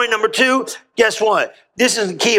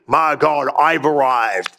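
lower, lightly pompous mock boast; mock self-important; 7 semitones lower, much slower than usual. Preacher disowning a boast to his congregation, mildly mock-pompous. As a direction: put on a touch of grand self-satisfaction.

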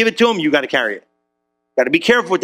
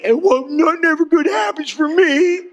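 whiny complaint; 8 semitones higher than usual. Retold or imitated. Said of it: "said it in some sort of way".

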